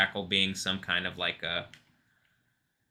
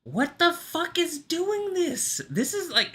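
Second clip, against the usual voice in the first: very high, drawn-out squeal of a question; high-pitched disbelief; slower than usual. Real, not acted. Speaker asking what is doing this, high-pitched disbelief. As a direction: squeal it slowly, far above your usual pitch. Startled exclamation, far above usual pitch.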